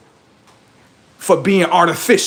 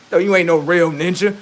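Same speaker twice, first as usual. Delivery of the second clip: ordinary delivery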